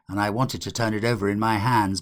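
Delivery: ordinary delivery, nothing marked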